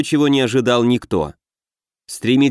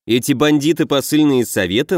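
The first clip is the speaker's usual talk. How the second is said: usual speaking voice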